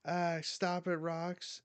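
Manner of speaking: high-pitched mock plea; acted alarm. Victims being cut down beg.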